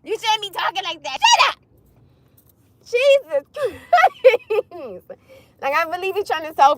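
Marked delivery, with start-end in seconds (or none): 1.2-1.6 very high, squawked retort; exasperated outburst. Acted. Mock-arguing back at critics. 2.8-5.1 long, high, laugh-broken exclamation; exasperated laughing. Real, not acted. Exasperated, laughing at own rant.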